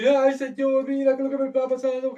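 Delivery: high, loud mock wail; hammed-up weepy plea to God; mock tearful complaining. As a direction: whine it like a self-pitying crybaby. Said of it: "Para aquellos que se quejan, viven llorando".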